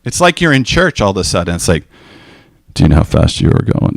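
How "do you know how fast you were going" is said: low, stern officer's challenge; imitated accusation in a much lower pitch; accusing traffic cop. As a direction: play a cop confronting a speeder. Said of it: "It's an accusation".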